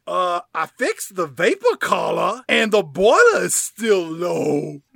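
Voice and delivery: loud, exaggerated Southern belle; Daisy. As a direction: play up a Southern accent loudly. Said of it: "And Daisy says".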